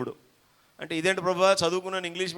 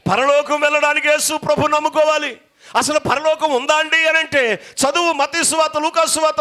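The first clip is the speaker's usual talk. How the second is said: high, loud pulpit shouting; fiery preaching; 9 semitones higher, 8 dB louder than usual. Acted. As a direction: preach it with full force. Preaching at full force.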